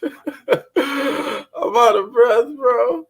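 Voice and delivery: winded and cracking up while saying it; real laugh, slow and high, out of breath; breathless laugh. Real, not acted. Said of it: "Y'all know I'm out of breath".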